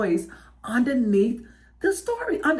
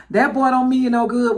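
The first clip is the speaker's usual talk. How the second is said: louder, quicker daddy warning; raised-voice mock fatherly warning; disapproving daddy; 5 dB louder, faster than usual. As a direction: imitate a daddy putting his foot down. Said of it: "the daddy be, like, side-eyeing the boy".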